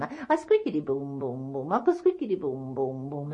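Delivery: rhythmic squeak, then dropping booms; weaving loom noise. Acted. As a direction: imitate a shuttle clacking back and forth. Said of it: "and a weaving".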